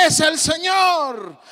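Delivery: high, slow ringing call; shouted call. Retold or imitated. John calling to the disciples from the boat, shouting. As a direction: call it out across the water. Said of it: "les grita".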